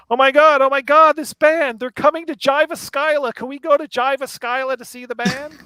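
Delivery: high and loud, eager; excited gushing. Retold or imitated. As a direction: gush about the band. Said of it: "It's like".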